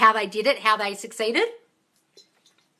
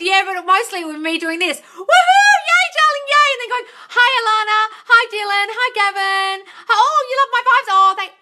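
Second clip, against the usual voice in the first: high, loud screaming hype greetings; mock hype host; 13 semitones higher, 9 dB louder than usual. Mocking her own live-stream hype to a friend: high, loud screaming. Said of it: "why is this bitch screaming in my ear".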